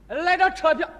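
calling out. Acted. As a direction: call out to get the conductor's attention. Passenger calling the ignoring conductor.

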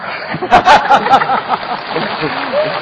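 a long, real burst of laughter that rises at the end; hearty laugh. Real, not acted. Neighbour laughs at the reconciled driver, hearty laugh. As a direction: laugh freely at the happy ending.

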